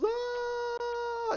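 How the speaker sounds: long, very high "ah"; mock falling scream. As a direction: imitate a man plummeting. Dropped man screams while falling.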